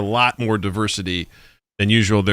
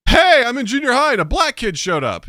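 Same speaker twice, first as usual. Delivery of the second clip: put-on much higher, amazed kid voice; mock wide-eyed kid; 13 semitones higher than usual. Mock kid gawking at a new classmate. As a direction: play the small-town kid gawking. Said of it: "it's like".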